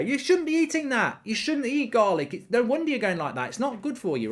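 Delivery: high-pitched imitation of someone telling off a pet; mock scolding dog owner. Owner fussing at the dog that ate garlic, mock high-pitched scolding. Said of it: "And she, so she goes".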